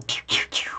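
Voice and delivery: whispered toy laser pews; pew sound effect. Retold or imitated. Gamers firing lasers, whispered pew pew. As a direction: mimic a tiny blaster, under the breath. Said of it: "could be going like".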